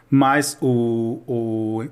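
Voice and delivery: normal talking voice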